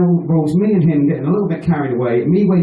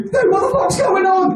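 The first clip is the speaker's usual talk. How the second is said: much higher, startled yelling; high panicked yell. Retold or imitated. Flatmate waking to a burning pillow, panicked high yell. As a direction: shout it like you just woke up next to a fire.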